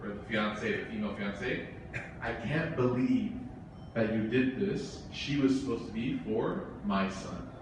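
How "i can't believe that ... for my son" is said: quiet bitter guest. Retold or imitated. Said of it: "said out loud to the mother".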